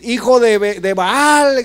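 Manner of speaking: loud mock insult shouted at the king; retold yell. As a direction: hurl it like a roadside heckler.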